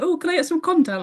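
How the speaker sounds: high-pitched mock request for a favour; imitating Elliot. Team manager badgering a player at dinner, high mock pleading.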